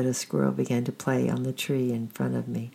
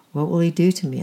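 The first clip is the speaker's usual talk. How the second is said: put-on a scared little girl's plea, higher and louder; fearful child blurting a worried question; anxious small girl asking; frightened; 4 semitones higher, 7 dB louder, faster than usual. A narrator's reading. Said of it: "I whispered".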